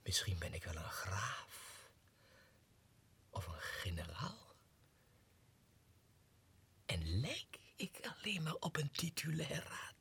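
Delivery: slow, soft secretive whisper; conspiratorial whisper. Acted. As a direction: confide a dangerous secret. Secret fantasy of nobility. (samenzweerderig fluisteren)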